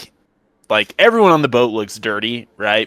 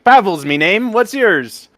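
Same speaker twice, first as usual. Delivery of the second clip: high, eager halfling voice; Pavel; 8 semitones higher, 3 dB louder than usual. Acted. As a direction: introduce yourself brightly as a small character. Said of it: "He's very excited to see".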